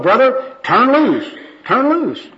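very high, ringing gospel plea; preachy high-pitched call; fervent exhortation. Acted. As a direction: urge it twice like a zealous evangelist. Worker exhorts the bewildered bum.

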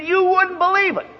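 high-pitched imitation of awestruck teen; smitten son. Son telling dad about his new girlfriend, awestruck.

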